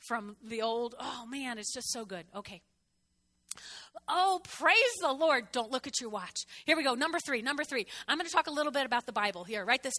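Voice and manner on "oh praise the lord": high, loud, slow "praise the Lord"; praise exclamation. Real, not acted. Preacher praising God before the congregation, drawn-out.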